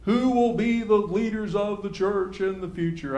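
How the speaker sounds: mock the way church people pose it; mimicked church talk. Class member asking about the church's future, mimicked church talk. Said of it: "we talk like this sometimes".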